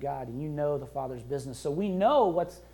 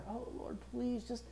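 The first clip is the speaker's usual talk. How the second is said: much softer, higher pleading voice; begging prayer; 6 semitones higher, 8 dB quieter than usual. Retold or imitated. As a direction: beg pitifully like a beggar. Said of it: "We don't have to beg the Father".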